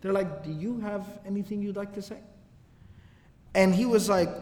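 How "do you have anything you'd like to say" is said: softer, quietly menacing question; soft warning. Retold or imitated. Ansar daring Abdullah to speak, quiet and menacing. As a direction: ask it softly, like a threat. Ansar confront him at the door.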